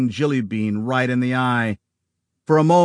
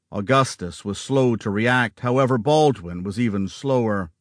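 plain reading voice

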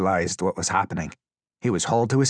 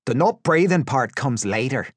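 higher, cheeky threat; gleeful raised-voice menace; taunting captor; 6 semitones higher, 4 dB louder than usual. A narrator's reading. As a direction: taunt him with what's coming. Captor taunting suffocating prisoner.

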